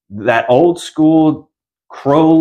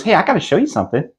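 higher, fast and giggly; creepy friend; 7 semitones higher, much faster than usual. Retold or imitated. Friend luring Carly, fast and giggly. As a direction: rush it with a giggle.

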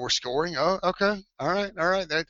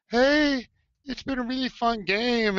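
mocking cheerleader; 7 semitones higher than usual. Duke cheerleader greeting the sulking yell leaders, mock-sweet and high. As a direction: play a perky cheerleader, hammed up. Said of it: "And they look at her like".

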